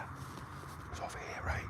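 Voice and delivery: slow, hushed whisper; real whisper in the dark. Real, not acted. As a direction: keep it low so nothing hears. Hiding in the woods.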